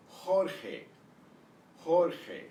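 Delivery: "Jorge" as HOR-kheh, J a throaty h; exaggerated Spanish pronunciation. Shown as a demonstration. Teacher modelling "Jorge" for students to repeat, exaggerated Spanish sounds. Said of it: "trying to exaggerate a little bit".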